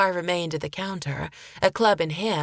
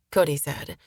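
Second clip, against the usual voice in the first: plain reading voice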